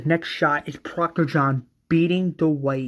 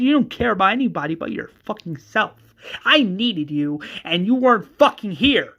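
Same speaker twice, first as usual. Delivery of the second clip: higher, louder, mounting angry rant; raised-voice outburst of betrayal; furious tirade; 4 semitones higher, 4 dB louder than usual. Acted. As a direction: pound each accusation harder as it builds. Acted furious tirade at Strand.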